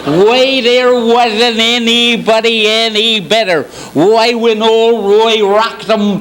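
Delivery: put-on high, drawn-out old cowboy voice; Gabby Hayes. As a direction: stretch each phrase slowly like a western sidekick. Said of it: "the Gabby Hayes".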